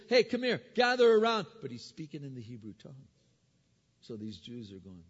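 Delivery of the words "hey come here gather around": high, loud call to gather a crowd; raised, higher voice beckoning people in. Acted. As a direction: summon the whole crowd from the stairs. Paul calling crowd from stairs.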